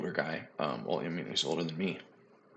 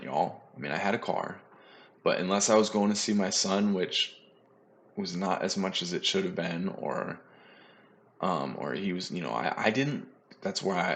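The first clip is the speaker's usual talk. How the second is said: ordinary talk, nothing marked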